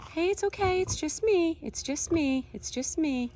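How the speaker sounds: high, gentle reassuring voice; soothing high coo. Real, not acted. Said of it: "I don't wanna startle her".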